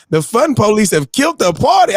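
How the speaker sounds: loud, high comic exasperation; exasperated fake-angry outburst; mock outrage. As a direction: shout it like the party just died. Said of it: "I was mad".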